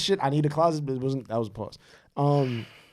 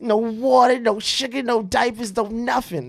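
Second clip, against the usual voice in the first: much higher, slow, laughing mock woman's whine; hammed-up mimicry of a complaining mother; mocking nagging woman; 12 semitones higher, 11 dB louder, much slower than usual. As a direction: lampoon her list of complaints, squealing through laughs.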